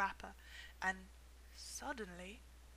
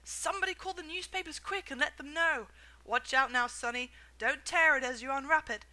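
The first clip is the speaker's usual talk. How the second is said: loud, higher excited shouting; excited yelling; 4 semitones higher, 9 dB louder than usual. A narrator's reading. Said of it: "he yelled".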